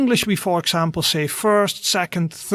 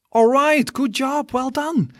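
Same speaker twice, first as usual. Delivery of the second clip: cheerful praise; 7 semitones higher than usual. Real, not acted. Praising learner after repetition.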